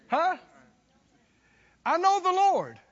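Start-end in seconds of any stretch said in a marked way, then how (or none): 1.9-2.8 fervent preaching. Acted. Preaching to the congregation.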